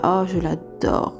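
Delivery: usual speaking voice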